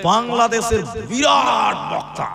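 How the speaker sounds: slow, stretched announcer-like praise; grandiose build-up. Retold or imitated. Boy naming the source of his prayer, grandiose build-up. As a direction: draw out the big title for effect. Boy hails a great speaker.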